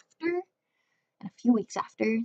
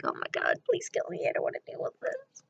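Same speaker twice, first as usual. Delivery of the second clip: softer, half-voiced mock despair; exasperated aside; 4 dB quieter, faster than usual. Her exasperated inner reaction, retold.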